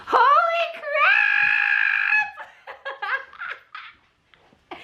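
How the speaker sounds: high, drawn-out shriek; crazy excited. Real, not acted. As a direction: shriek it in giddy disbelief. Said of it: "I'm crazy".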